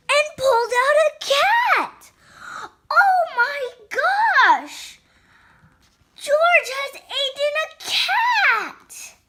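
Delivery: high, slow, shrieky mock amazement; shocked. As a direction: exclaim it in hammed-up shock. Shock at cat pulled out.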